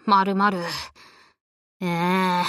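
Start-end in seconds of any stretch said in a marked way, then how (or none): 1.8-2.4 1 whine. Acted. Faced with heap of paperwork.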